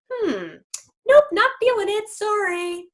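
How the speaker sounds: picky dog. Pretend. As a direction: toss off a fussy "nope". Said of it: "your dog goes".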